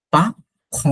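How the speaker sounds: পা খ syllable by syllable; split pa kh. Shown as a demonstration. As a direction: break পা খ into two sounds. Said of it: "একটা করে ফেলি".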